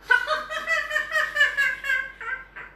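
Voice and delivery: high, mocking laugh at the potato. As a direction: laugh him off, high and scornful.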